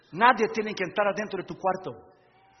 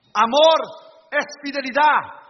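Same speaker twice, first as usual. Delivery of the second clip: proclaiming; 4 semitones higher, 8 dB louder than usual. Real, not acted. Preacher proclaims key word.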